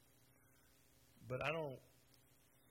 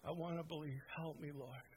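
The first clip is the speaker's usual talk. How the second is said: led prayer; 3 semitones higher, 3 dB quieter than usual. Acted. Preacher leading seekers in prayer, soft prayerful voice. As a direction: pray it gently for them to repeat.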